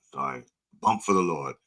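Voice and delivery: ordinary talk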